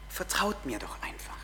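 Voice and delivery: soft whispered plea; intimate whisper. Acted. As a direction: breathe it like a secret invitation. Gottes Stimme, eindringlich.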